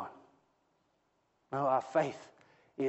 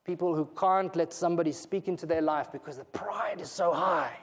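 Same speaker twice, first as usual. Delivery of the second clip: normal talking voice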